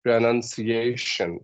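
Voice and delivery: "pronunciation" drawn out slowly with stress on the A of -ciation; word stress demo. Shown as a demonstration. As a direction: stretch "pronunciation" to show its stressed syllable. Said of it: "the stress should fall on".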